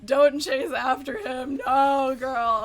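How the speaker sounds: drawn-out, loud protest; loud, slow "no, girl" exasperation; loud pleading. Retold or imitated. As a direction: plead with a friend at full voice. Pleads with woman on TV show.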